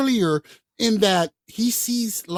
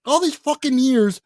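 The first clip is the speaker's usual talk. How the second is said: mock outrage; 7 semitones higher, 5 dB louder than usual. Rant continues.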